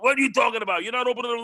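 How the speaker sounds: high-pitched mobster impression; Cuomo as mobster. Podcast guest imitating the governor getting tight, mobster-style. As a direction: do a worked-up mob boss, pitched well up. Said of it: "almost like a mob, a mobster".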